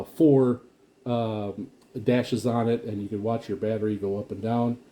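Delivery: ordinary talk, nothing marked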